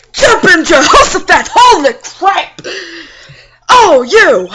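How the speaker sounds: loud high startled yelps; flustered exclamations; startled old man. Acted. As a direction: jump out of your skin.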